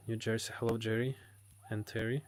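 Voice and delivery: ordinary delivery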